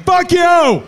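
loud, high-pitched retold outburst; defiant shout. Son refusing dad's panties punishment, defiant shout. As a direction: yell it back, pitch leaping up.

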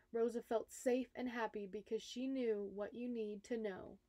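ordinary delivery, nothing marked

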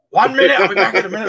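hurried dad fobbing off his son; flustered dad. Retold or imitated. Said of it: "And I'm like".

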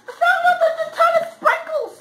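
high, loud, excited squeal. Real, not acted. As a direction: squeal with delight. Excited find while unboxing.

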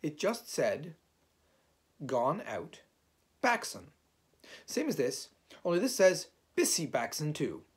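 high, loud, emphatic report; impatient answer. A narrator's reading. Rabbit repeating the notice's words for Owl, impatient. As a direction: reel it off with impatience.

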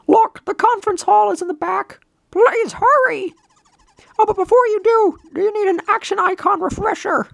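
high, urgent cartoon voice; helpful game guide. Guide offering Mario a refresher, high and eager. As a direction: direct the hero in a squeaky voice.

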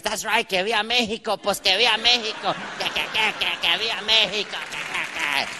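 lightly voiced, stuttering patriotic chant; mock proud chant, tripping over "que"; mother-in-law impression. Mother-in-law in his face on Cinco de Mayo, mock-proud chant.

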